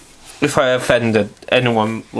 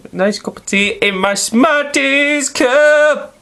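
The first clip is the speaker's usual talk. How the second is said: silly squeaky voice; 11 semitones higher, slower than usual. Pretend. Silly voice about his mug.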